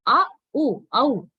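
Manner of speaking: "a", "u" said apart, then blended as "au"; diphthong broken down. Shown as a demonstration. Teacher explaining what the underlined diphthong says, sounded out in parts. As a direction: sound out "a" and "u" separately, then glide them into "au". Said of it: "So actually it says".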